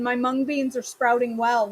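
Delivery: usual speaking voice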